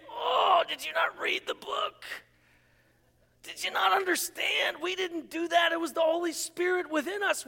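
high-pitched, exasperated apostles; acted dismayed protest. Acted apostles objecting to the title.

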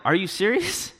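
high-pitched incredulous question, rising at the end; mock disbelief. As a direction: pitch it up like you can't believe it. Retold disbelief at catching covid.

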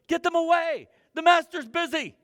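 loud, high, brusque shout; disciple shooing children. Acted. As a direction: bark at the crowd to back off.

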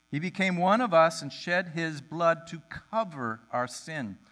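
ordinary delivery

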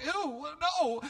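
very high, squeamish recoil; acted disgust. Everybody there watching the woman, squeamishly disgusted. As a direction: squeal it as if grossed out.

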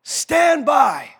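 very slow, high and loud; thundering command. Real, not acted. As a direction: bellow it from the pulpit. Climactic command.

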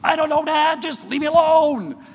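put-on high, loud whining teen brushing off dad; whiny teenager. Pastor imitating a sulky teen brushing off dad, high and loud. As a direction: whine it like a fed-up teenager. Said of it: "the typical response".

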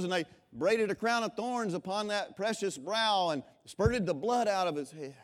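loud, high preaching to the congregation; full-force preaching. Acted. Preacher booming to the congregation about Lazarus, full-force preaching. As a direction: preach it out with fire.

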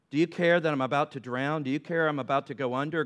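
normal talking voice

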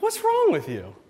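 high squeak of disbelief; mock disbelief. Pastor mocking the weird money stunts, squeaky disbelief. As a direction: pitch way up in mock shock.

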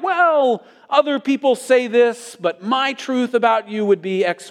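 exaggerated noncommittal caricature; mock postmodern hedging. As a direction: parody a wishy-washy relativist up high. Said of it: "postmodern language like".

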